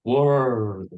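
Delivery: rolled r in "whir", tongue vibrating; a rolled Scottish r; Scottish trilled r. Shown as a demonstration. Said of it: "a very strong, uh, whir".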